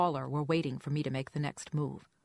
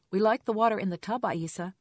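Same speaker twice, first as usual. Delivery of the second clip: raised, lifted parent voice; higher, louder, aimed at a small child; talking to toddler; 6 semitones higher, 6 dB louder than usual. A narrator's reading. Mother tells splashing toddler about the water, child-directed voice.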